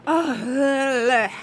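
a loud, drawn-out groan of fatigue; tired groan. Real, not acted. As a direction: let out a weary groan. Groan about being breathless.

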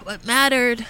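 pushing words out through tears; getting emotional. Real, not acted. Radio guest recovering from tears, voice still cracking. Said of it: "I'm just gonna get emotional".

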